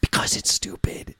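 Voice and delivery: whispered punchline to a scolding; mock whisper. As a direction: drop to a whisper to land the point.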